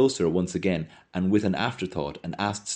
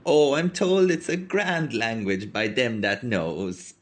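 put-on old woman voice; 5 semitones higher, slower than usual. Old milkwoman voiced in story.

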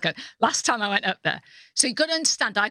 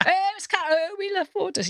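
high gushing fan voice; mock fan gushing; 12 semitones higher than usual. Fans at the table, gushing at Carol in a high voice. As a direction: gush like a starstruck fan. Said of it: "everyone's going".

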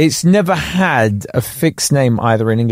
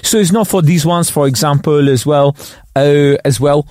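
put-on French accent with a touch of Cockney; French expert's accent. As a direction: imitate a French pundit who learned English in London. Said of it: "the French expert that comes on".

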